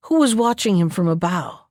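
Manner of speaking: plain reading voice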